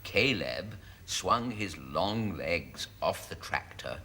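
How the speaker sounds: low, measured novel reading; story narration. Acted. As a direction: read it as overblown rustic romance. Reads a racy novel aloud.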